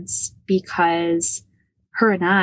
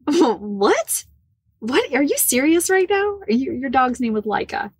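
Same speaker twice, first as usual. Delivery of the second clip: high, rising mock astonishment; father stunned that both dogs were named Laika; retold disbelief; 6 semitones higher than usual. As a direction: act out his stunned double take, pitch climbing. Father's shocked reaction retold.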